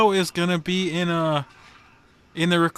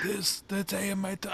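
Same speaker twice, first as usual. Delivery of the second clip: quiet mobster don impression, breaking off; lightly voiced old mafia boss; Godfather voice; 2 semitones higher, 10 dB quieter than usual. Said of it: "I can't do that voice".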